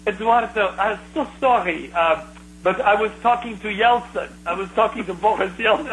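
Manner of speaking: put-on thick Russian accent; imitating a Russian woman's accent. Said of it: "in this thick Russian accent".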